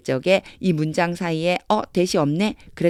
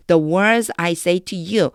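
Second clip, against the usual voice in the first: slow reading; much slower than usual. Shown as a demonstration. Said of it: "그럼 다시 해볼게요".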